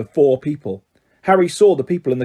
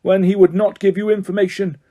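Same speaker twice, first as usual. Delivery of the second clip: raised, higher forceful charges; bellowing judge; 6 semitones higher than usual. A narrator's reading. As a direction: hammer the accusations. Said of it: "bellowed Mister Crouch".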